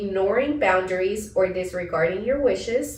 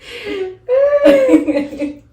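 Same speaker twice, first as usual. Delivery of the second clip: high, loud laughing squeal; real laughter; 9 dB louder than usual. Real, not acted. Host cracks up over a slip, high laughing.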